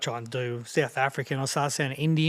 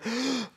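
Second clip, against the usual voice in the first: a big gasping breath in, pitched up; sharp gasp; 9 semitones higher than usual. Shown as a demonstration. Rapper showing kids a bad breath: sharp gasp. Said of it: "if you breathe in, real".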